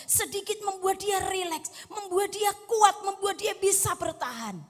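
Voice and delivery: high, mounting pulpit urgency; fervent sermon voice, climbing in pitch; impassioned preaching. Real, not acted. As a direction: build insistently phrase on phrase. Preaches with raised voice.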